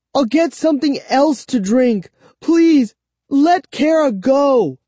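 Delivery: high-pitched, urgent bargaining plea; desperate begging. A narrator's reading. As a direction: plead for a friend's release. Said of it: "I begged".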